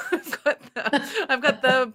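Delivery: giggling amusement breaking into the line; laughing. Real, not acted. Laughing at her own old looks.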